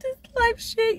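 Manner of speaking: fake-sob the complaint like a drama queen; mock whiny crybaby. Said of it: "people be like".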